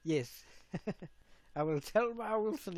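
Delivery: usual speaking voice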